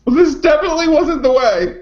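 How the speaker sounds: high-pitched squeaky laughing talk; laughing high squeal. Real, not acted. Co-host laughing at the great-grandpa joke, squealing. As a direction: squeal the words through helpless laughter.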